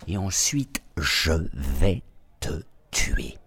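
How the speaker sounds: slow, whispered death threat; menacing whisper. Acted. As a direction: breathe the threat slowly. Boss threatens agent on phone.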